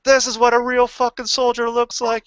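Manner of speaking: high, loud mock tirade full of swearing; retold drill sergeant yelling. Drill sergeant cursing the pogue brigade, imitated high angry yell. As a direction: rip into them like a furious drill sergeant. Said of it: "he's yelling".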